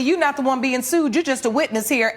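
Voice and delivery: ordinary talk, nothing marked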